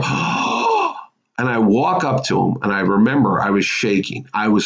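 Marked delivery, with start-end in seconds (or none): 0.0-0.6 a hushed, voiceless little "oh" of awe; stunned breathy gasp. Retold or imitated. Fan meeting his childhood hero gasps in awe. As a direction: gasp it out, starstruck and barely audible.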